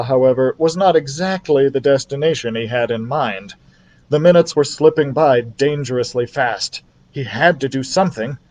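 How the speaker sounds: usual speaking voice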